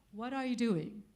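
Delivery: ordinary talk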